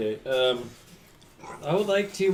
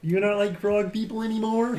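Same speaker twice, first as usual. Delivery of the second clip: frog voice; 7 semitones higher than usual. Acted. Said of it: "Rick Romano's here?".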